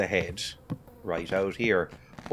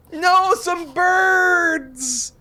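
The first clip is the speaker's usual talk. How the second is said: drawn-out, very high, loud cry; startled shriek; 8 dB louder, much slower than usual. Pretend. Player startled by birds, long high shriek.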